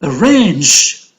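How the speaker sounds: "Arrange" with RANGE stressed; a-RRANGE, weight on the end of "Arrange"; stress on second syllable. Shown as a demonstration. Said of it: "So range is stressed".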